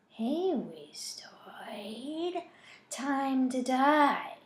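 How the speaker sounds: slow, drawn-out taunting whisper; menacing whisper. A narrator's reading. Dirtbag taunting Zach from inside his head, menacing whisper. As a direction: whisper it slowly, stretching the words. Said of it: "Dirtbag whispers".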